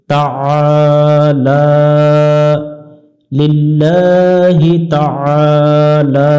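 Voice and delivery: slow তাআলা with the ain pressed from the throat; long drawn vowel in তাআলা, throat pressed; Arabic pronunciation. Shown as a demonstration. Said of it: "আইনটা একটু চেপে পড়তে হবে".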